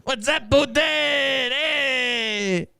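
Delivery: high-pitched mimicry of a botched Russian accent, sounding Mexican; hammed-up Mexican greeting; mock Mexican accent. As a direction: mockingly sing out in a cartoon Mexican accent. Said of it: "my Mexican impression probably sounds as cringy".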